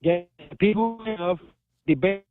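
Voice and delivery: usual speaking voice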